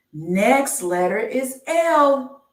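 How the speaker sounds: slow sing-song reveal of the next letter; drawn-out announcement. Acted. Slow game-show style letter reveal.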